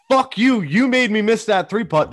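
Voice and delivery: imitating a furious dad. Angry dad yelling at his teen son after a missed putt. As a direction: snap it at the kid like a sore loser. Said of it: "freaked out".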